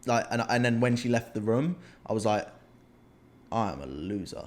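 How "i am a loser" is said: low self-loathing. Retold or imitated. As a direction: lower and softer, dragging himself down. Self-talk in bed.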